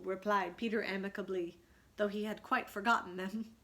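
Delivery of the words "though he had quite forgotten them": plain reading voice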